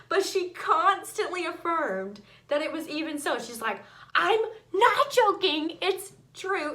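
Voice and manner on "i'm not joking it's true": higher and louder, pleading to be believed; insistent. Acted. Rhoda insisting to doubters that Peter is there, high insistent plea. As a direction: push hard, she's not joking.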